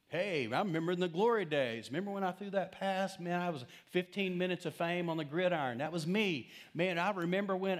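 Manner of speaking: hammed-up old athlete reliving glory days; boastful has-been. Preacher imitating a man bragging about high school football, mock-excited.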